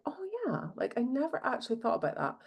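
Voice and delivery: a soft "oh yeah" of realisation; dawning realisation. Retold or imitated. Friend realising she has manifested, quietly surprised. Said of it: "And then they're like".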